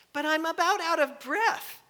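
high, whiny mock complaint; whiny athlete. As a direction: act the fussy girl out of breath.

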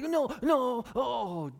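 high, loud, hammed-up alarm; mock dismay. Preacher playing someone who just said something rash, dismayed. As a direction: act out wanting the words back. Acts regretting words just said.

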